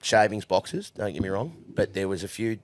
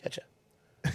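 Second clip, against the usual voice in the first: mumbled goodbye; 8 dB quieter than usual. Retold or imitated. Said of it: "he went".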